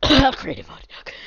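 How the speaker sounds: slow, loud words through a cough; fake-cough remark. Coughed repeat of the aside.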